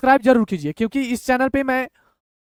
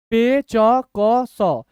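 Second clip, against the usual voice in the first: very slow, each letter standing alone; syllable by syllable; much slower than usual. Shown as a demonstration. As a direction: sound out letters with gaps between. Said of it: "तोड़ तोड़ के पढ़िए".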